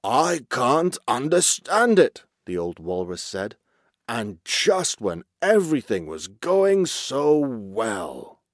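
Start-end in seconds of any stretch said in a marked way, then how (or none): 0.0-2.2 slow, loud, raised wail of dismay; bewildered old chief. A narrator's reading. Old walrus chief left without his herd, bewildered lament. 4.1-8.4 slow, drawn-out lament; dismayed old chief. A narrator's reading. Old walrus chief mourning his lost good times, dismayed lament.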